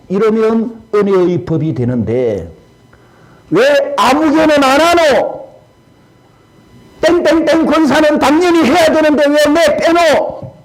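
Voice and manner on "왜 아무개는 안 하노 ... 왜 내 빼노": indignant complaining. Retold or imitated. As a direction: accuse the ones who skip the work. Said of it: "안 하는 사람을 불평하기 시작합니다".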